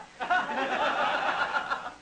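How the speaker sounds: a short amused laugh at himself; real chuckle. Real, not acted. Lecturer laughing at his own bragging to the audience, chuckling. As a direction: laugh off the boast.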